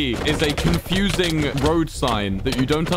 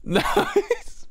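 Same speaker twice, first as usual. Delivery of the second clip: high laugh through the word. Real, not acted. Laughs reading chat.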